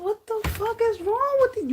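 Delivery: very high-pitched, loud retold outburst; exasperated outburst. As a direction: throw it out in a high, incredulous yelp. Retells her exasperated comeback to critics.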